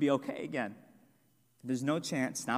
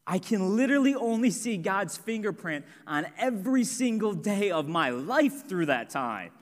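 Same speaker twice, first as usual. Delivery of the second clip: impassioned preaching; 7 semitones higher, 4 dB louder than usual. Acted. Pastor declaring what he'll be thankful for, impassioned. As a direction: voice climbs higher and louder, slowing on "And I decide". Climax of his testimony.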